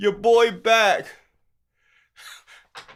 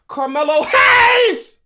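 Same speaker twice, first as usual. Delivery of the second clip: shout; 8 semitones higher, 5 dB louder, slower than usual. Real, not acted. Shouts missing wrestler's name.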